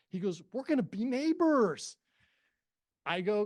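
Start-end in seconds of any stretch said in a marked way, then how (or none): 0.5-2.0 high, loud neighborly announcement; mock enthusiasm. Owner announcing to the agent they'll be neighbors, excited. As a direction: crow it out, pitch swooping up.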